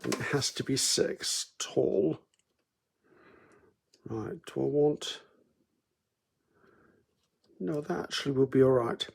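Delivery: soft, half-whispered thinking aloud; muttering to myself. Real, not acted. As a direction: mutter the sums under your breath. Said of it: "Sorry, I mutter to myself".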